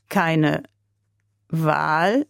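slow, puzzled, rising question on Wahl; slow repetition. A narrator's reading. Russian soldier echoing Lotte's words, slow and puzzled. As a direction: repeat it slowly as if weighing each word. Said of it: "wiederholte der Russe langsam".

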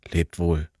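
soft, low goodbye; quiet farewell. A narrator's reading. Young soldier bidding them farewell, resigned. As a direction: say goodbye expecting death.

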